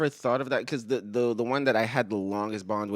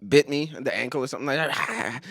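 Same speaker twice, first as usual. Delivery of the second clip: usual speaking voice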